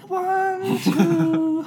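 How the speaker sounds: put-on very high, drawn-out horror-film child; eerie sing-song counting in a tiny high voice; creepy little girl. Scary little girl playing hopscotch in a horror film, creepy child voice. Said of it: "Yeah, scary little girls".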